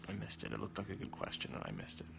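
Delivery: soft, near-whispered I missed it; muttering to himself. Real, not acted. Missed a question, to herself.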